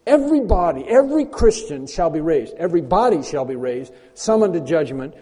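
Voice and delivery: loud, high fervent preaching; preaching at full force. Real, not acted. Preacher rousing his congregation, loud fervent proclamation. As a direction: proclaim it like a trumpet call.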